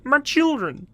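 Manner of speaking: high, loud, whiny desperate parent; hammed-up high pleading voice; funny voice. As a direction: wail about the starving plushies. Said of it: "if I do a funny voice".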